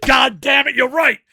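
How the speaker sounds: loud, high burst of resolve; fired-up shout. Retold or imitated. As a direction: yell it like you just got inspired. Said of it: "And I'm like".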